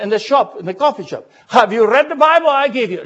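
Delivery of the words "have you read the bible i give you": retold question. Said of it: "I asked my fellow people".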